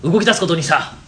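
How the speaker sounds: booming boss voice; loud bossy company president. Acted. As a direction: bark it like a pompous boss. Acted boss skit, loud. (威張った社長の大声)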